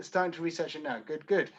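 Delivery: usual speaking voice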